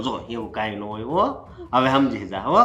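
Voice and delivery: a low hush so nobody overhears; hushed warning. Acted. Husband hushes wife's accusations. (ધીમા અવાજે ચેતવણી)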